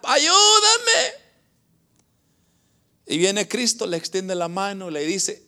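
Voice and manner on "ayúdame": high, drawn-out wail for help; acted cry for help. Sinking disciple calls to Christ, high acted cry. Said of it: "comienza a gritar".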